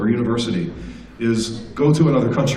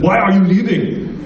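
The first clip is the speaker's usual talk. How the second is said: put-on slow, higher Austrian-accented question; mock Austrian ambassador, drawn out; 7 semitones higher, 4 dB louder than usual. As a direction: catch someone sneaking out in an Austrian accent. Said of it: "never quite lost his Austrian accent".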